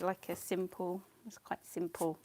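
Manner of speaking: usual speaking voice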